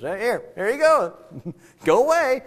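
put-on high-pitched, shooing older brother; dismissive brush-off. Older brother hands younger one a dollar, dismissively.

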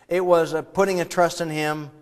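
ordinary talk

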